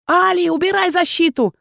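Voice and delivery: high, urgent bellow; deafening shout. Acted. Erkhan bellows to his teammate sensing danger. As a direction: roar the warning. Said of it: "оглушительно проорал".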